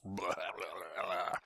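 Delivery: slow, low mocking blah blah; dismissive blah. Planner mocking broker pitch to viewers, dismissive. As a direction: brush off the broker spiel with scorn.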